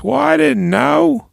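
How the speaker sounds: very high, slow, meek excuse; Tommy excusing himself to his partner; sheepish Tommy. Retold or imitated. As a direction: whine it small and guilty. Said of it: "And he goes".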